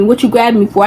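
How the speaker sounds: ordinary talk, nothing marked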